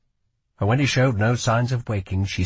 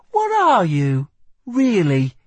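put-on higher, sharp girl voice; young woman voice; 9 semitones higher, 5 dB louder, slower than usual. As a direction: voice a wary young thief. Lila presses Kell.

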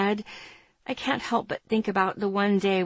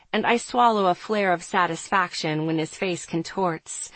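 usual speaking voice

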